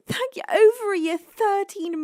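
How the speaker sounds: high, thrilled thank you; delighted thanks. Real, not acted. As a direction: gush with gratitude. Thanking a long-time supporter.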